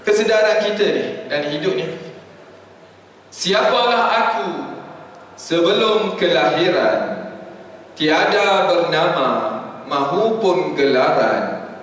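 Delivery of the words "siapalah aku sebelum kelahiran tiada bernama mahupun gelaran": slow, solemn poem reading; poem recital. Acted. Preacher closing his talk with a slow, solemn poem. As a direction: let each line sink in slowly.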